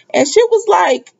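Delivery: ordinary talk, nothing marked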